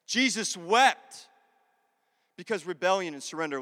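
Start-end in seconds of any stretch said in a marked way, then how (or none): 0.0-1.3 "Jesus wept" very slow, with "wept" stretched out; emphatic verse quote. Shown as a demonstration. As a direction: lean long and hard on "wept". Verse repeated again for weight.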